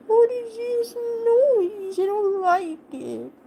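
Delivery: high, slow put-on dog voice; voicing the dog. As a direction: speak for the grumpy dog, high and drawn out. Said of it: "He's like".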